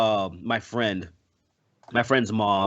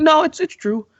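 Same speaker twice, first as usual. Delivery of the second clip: high, squeaky no it's true; squeaky insistent mom; 4 dB louder than usual. Retold or imitated. Mocking his friend's devout mom, much higher. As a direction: mimic her dead-earnest insistence. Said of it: "But just like".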